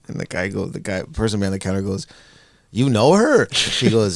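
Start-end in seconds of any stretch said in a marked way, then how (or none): 2.7-3.5 higher, louder incredulous question; mock astonishment, pitched up; imitating surprised clerk. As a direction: act out a stunned bystander. Startled clerk questions the dad.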